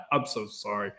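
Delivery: laughing. Real, not acted. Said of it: "I'm just laughing in general".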